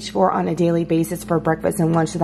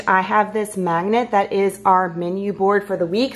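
normal talking voice

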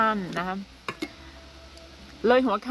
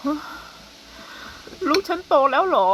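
drawn-out higher pleading question, rising at the end; dad's wistful question; 7 semitones higher, 3 dB louder, much slower than usual. Retold or imitated. Dad watching his daughter walk to school, slow wistful higher question. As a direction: stretch it slowly like a father in disbelief. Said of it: "แกรู้สึกแบบ".

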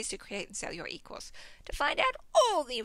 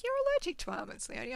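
naturopath; 8 semitones higher, faster than usual. Retold or imitated. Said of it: "the naturopath's like".

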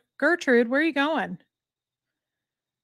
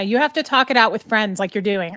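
ordinary talk

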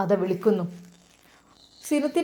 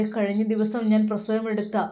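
usual speaking voice

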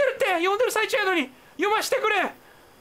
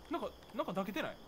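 fast, hushed nervous question; hushed and scared; 8 semitones lower, 14 dB quieter, much faster than usual. Real, not acted. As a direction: whisper it out of fear. Spotting a witch, hushed fear.

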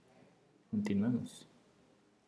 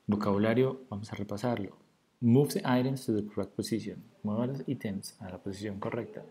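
ordinary delivery

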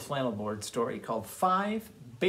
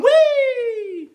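high, loud, long-held squeal of play; gleeful whee; 11 dB louder than usual. Acted. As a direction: let out a big playful whee. Bear throwing spoon across room.

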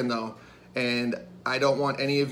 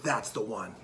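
much softer, low, conspiratorial aside; hushed awe; 4 semitones lower, 9 dB quieter than usual. Retold or imitated. Drunk buddies spot the turkey in a cage, hushed. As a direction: drop low, as if sighting the prize.

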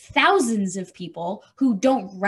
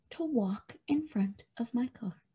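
whisper; 10 dB quieter than usual. Real, not acted. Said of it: "whispered aside".

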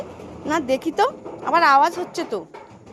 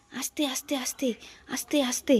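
hushed whispering, barely voiced; 7 dB quieter than usual. Mother creeping up on the umbrella she fears hides a ghost, whispering. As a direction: tiptoe toward the shaking umbrella in a whisper.

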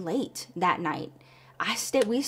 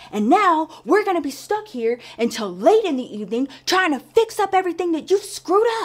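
loud, higher furious tirade, rising to a shriek at the end; furious boss yelling; 6 semitones higher, 7 dB louder than usual. Retold or imitated. Boss berating Rhonda in her office, furious loud yelling. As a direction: scream him down like an enraged boss. Boss screaming at coworker behind door.

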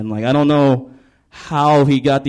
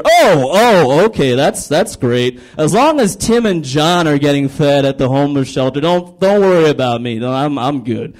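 mock-impressed sarcasm; 2 semitones higher, 4 dB louder than usual. Homeless man answering a volunteer's excuse, sarcastic and put-upon. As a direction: act hugely impressed, sarcastically.

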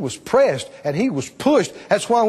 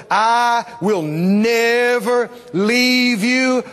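slow, stretched-out divine promise; powerful declaration; 5 semitones higher, much slower than usual. Retold or imitated. Preacher quoting God's promise never to leave, powerfully. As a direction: draw out each word with conviction. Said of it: "he says so powerfully".

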